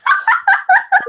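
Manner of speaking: high squealing giggle; embarrassed laugh. Real, not acted. Embarrassed after burping on podcast.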